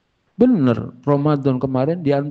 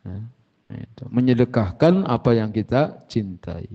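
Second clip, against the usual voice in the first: usual speaking voice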